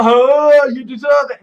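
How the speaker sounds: loud, high, sneering mock of an online commenter. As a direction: throw the nasty comment back as a jeer. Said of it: "horrible comments".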